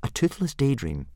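put-on high, mild boy voice; explaining boy. A narrator's reading. Boy explaining his dragon to the instructor, mildly. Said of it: "explained Hiccup".

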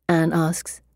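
usual speaking voice

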